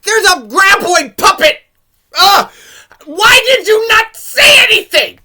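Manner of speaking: high, loud and slow outraged yell; loud, high, drawn-out cry of frustration; exasperated shout. Real, not acted. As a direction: scream at the screen in disbelief. Exasperated outburst at the movie.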